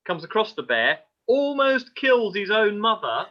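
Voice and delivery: high, loud, dramatic telling; dramatic storytelling. Real, not acted. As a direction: build the drama.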